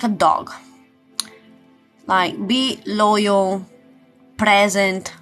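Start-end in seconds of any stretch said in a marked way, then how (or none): none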